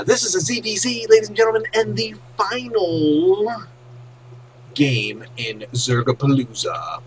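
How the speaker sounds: high, loud match announcer. Acted. Caster introducing the final game, in a loud announcer voice.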